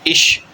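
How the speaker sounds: اش said with a short, quick i as in ich; German short i. Shown as a demonstration. Teacher demonstrating German ich, short quick i. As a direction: say اش with a brief short i. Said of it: "short".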